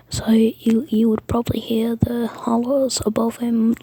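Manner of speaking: normal talking voice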